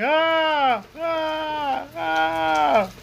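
repeated high "ah" yells; mock yell. Man keeps yelling on the floor, high mock yell. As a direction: holler high and steady, over and over. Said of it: "I just kept yelling".

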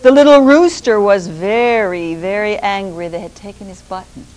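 usual speaking voice

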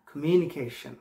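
কমিউনিকেশন slowly, ending in a light "shan"; practice pronunciation. Shown as a demonstration. Teacher drilling learners on the ending, slow "shan". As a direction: stretch কমিউনিকেশন, unrounded last vowel. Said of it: "practice করবো আন দিয়ে".

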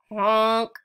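loud honking yell; truck honk. Acted. Dump truck pushing through, loud honk. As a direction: blast it like a horn. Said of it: "yelled a dump truck".

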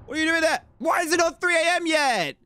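jittery high-pitched complaint; high panicked squeak. Real, not acted. Panicked at game night clock.

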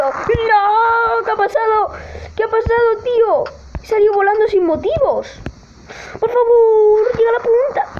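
high-pitched, exasperated gamer yell; outraged shouting. Real, not acted. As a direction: cry out in protest at being flung away. Flung away without reason.

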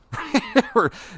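sudden high laugh; high amused laugh at the memory; high burst of laughter. Real, not acted. Laughing at parents' silly fight.

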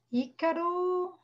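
ÍCARO drawn out slowly as a call. Real, not acted. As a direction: call for a missing pupil, stretching the name. Calling an absent pupil. (chamando o aluno, nome arrastado)

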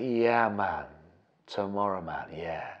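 low, soft, trailing stoner drawl; sleepy, unbothered mock stoner; imitated stoned tenant. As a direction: play him totally chilled, fading away. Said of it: "they're, they're, they're stoned".

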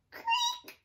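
high squeaky creak; thin high creak sound; creaky noise. Acted. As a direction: squeak it like an old door. Sound effect in story.

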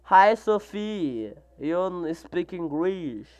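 slow, careful English; slow English reply to a viewer; slow English reading. Real, not acted. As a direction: say the English words slowly. Reading English chat slowly.

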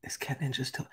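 hushed, urgent call for Cat Ninja; whispered call. Real, not acted. Streamer whispering to Cat Ninja in chat. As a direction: whisper the name to a chat viewer.

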